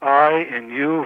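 ordinary delivery

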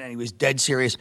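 usual speaking voice

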